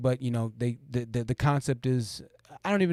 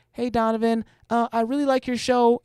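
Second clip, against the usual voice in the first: lightly higher, polite fan voice; sweet female caller; 8 semitones higher than usual. Retold or imitated. Streamer voicing a polite female caller praising the show, lightly higher. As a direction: play a harmless caller being nice. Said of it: "She'd be like".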